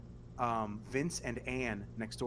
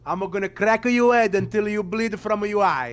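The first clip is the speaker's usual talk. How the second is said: mock old Italian grandma threat, loud and much higher; grandma's threat; 6 semitones higher, 13 dB louder than usual. Great-grandma threatening a child, loud mock fury. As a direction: bellow it like a furious old nonna, building.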